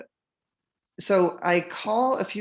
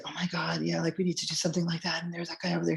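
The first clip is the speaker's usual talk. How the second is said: quiet, hushed and quick; hushed confiding; 6 dB quieter, faster than usual. Retold or imitated. Women at a meeting quietly warning her, hushed. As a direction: lean in and keep it down like sharing a secret.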